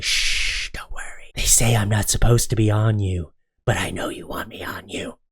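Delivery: hushed, seductive whisper. Pretend. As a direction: lean in and whisper temptingly. Pineapple seductively whispers to pizza.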